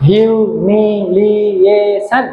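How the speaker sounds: "Humiliation" slowly, syllables stretched and joined. Shown as a demonstration. Teacher showing the syllables, "humiliation" slow and measured. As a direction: draw out each syllable of "humiliation". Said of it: "syllable আছে".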